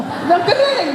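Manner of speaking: high, delighted cry of wonder; overjoyed. Retold or imitated. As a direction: marvel like a child on her first elevator ride. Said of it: "Sa sobrang tuwa".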